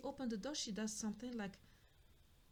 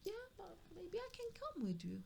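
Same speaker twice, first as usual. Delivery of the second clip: higher, hesitant wife voice, lightly imitated; a tentative mock ask from the wife; imitating the wife; 8 semitones higher, 4 dB quieter than usual. Wife asking to join trip.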